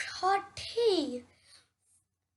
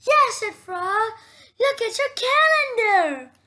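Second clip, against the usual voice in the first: high excited yes; 6 semitones higher, 7 dB louder than usual. Acted. Frog insists excitedly.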